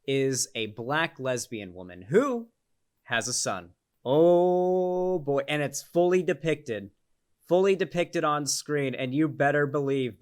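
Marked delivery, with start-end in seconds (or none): 4.0-5.4 long, held, higher and louder; mock alarm. Commentator bracing viewers for the backlash, mock alarm. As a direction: draw it out in mock anticipation. Mock dread at the coming outrage.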